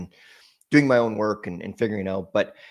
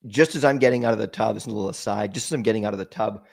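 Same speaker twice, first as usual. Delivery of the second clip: ordinary talk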